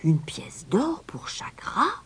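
high, hushed, slow disbelief; crowd murmur. A narrator's reading. Crowd echoes the stranger's price, in a hushed murmur. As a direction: murmur it as a high, astonished question. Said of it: "Un murmure parcourut la foule".

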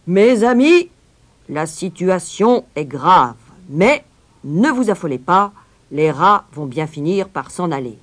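loud public-address voice, slowed at first; mayor calming crowd. A narrator's reading. Mayor tries to calm the townspeople, loud and declamatory. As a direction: address the crowd loudly, to settle them. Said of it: "pour essayer de les calmer".

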